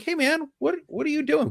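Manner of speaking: very high-pitched incredulous question; retold puzzled challenge. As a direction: call him out in high-pitched disbelief. Officer calling out, acted.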